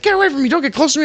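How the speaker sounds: very high, agitated mock warning; imitated yelling. Coworker warning off the man walking closer, mock yelling. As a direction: screech it to back him off. Coworker warns off advancing narrator.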